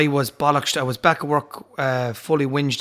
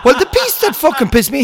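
high, loud laughing rant; real laugh at the memory; laughing outrage; 11 semitones higher, 8 dB louder than usual. Real, not acted. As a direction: laugh while getting worked up.

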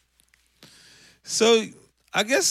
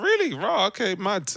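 high, incredulous Really? then a shrug; mock disbelief; 10 semitones higher than usual. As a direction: pitch jumps high on Really, then settles. Stunned reaction to a boast.

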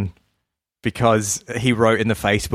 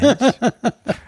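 high laugh; 3 dB louder than usual. Real, not acted. Laughing at own whine.

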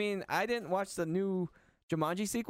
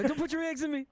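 mock high voice; 10 semitones higher than usual. Mock reply to alien eggs joke.

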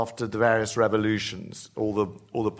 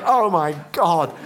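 mock gasp; 7 semitones higher, 6 dB louder, slower than usual. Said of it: "She said".

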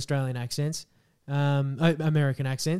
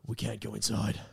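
low, quiet American audition line; hushed American voice-acting read; American accent; 6 semitones lower, 9 dB quieter than usual. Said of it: "do an American accent for your audition".

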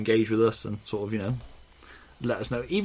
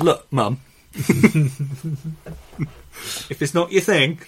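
laughing; 8 semitones higher, 7 dB louder, slower than usual. Retold or imitated. Quoting himself to mum, laughing.

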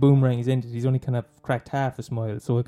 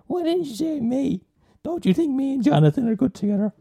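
whiny friend voice; 13 semitones higher than usual. Retold or imitated. Friend texting to complain she was left out, high whiny mock voice. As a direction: whine it up high like a hurt friend. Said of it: "getting texts saying going".

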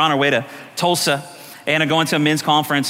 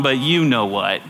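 slow, low insinuation; taunting enemy; 7 semitones lower, slower than usual. Acted. Enemy to celebrating worshipper, slow low taunt. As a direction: draw out the devil's sly reminder.

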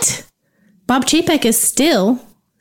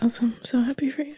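sarcastic whisper; 9 dB quieter, much faster than usual. Real, not acted.

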